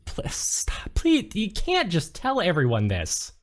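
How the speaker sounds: whispered stammer rising into high protest; embarrassed, half-whispered begging; flustered pleading. Acted. Nate begs her to stop.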